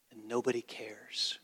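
hushed, unvoiced whisper; whispered, letting the sadness land. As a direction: let it drop to a whisper. Sparrow falls, nobody cares.

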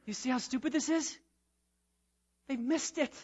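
ordinary talk

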